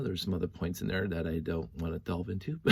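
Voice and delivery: ordinary talk, nothing marked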